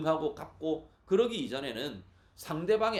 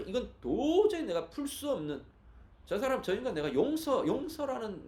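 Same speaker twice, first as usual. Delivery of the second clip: higher-pitched, acted exasperation; exasperated unforgiveness; 8 semitones higher than usual. Preacher voicing a hurt person unable to forgive, exasperated. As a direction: act out someone who simply cannot forgive. Acting out inability to forgive someone.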